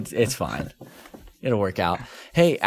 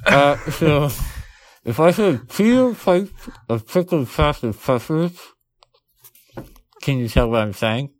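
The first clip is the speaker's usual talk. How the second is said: thick, muffled speech round a big mouthful; mouth full of marshmallows; 3 semitones higher, 3 dB louder than usual. Real, not acted. Host testing if co-host understands him, mouth stuffed. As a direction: struggle to form consonants with stuffed cheeks. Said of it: "mouthful of marshmallows".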